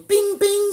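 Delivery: high sung ding-ding of a cabin chime; imitated seatbelt chime. Mocking the cheap airline's seatbelt sign with a high chime.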